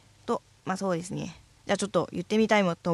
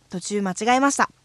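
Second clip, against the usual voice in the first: ordinary talk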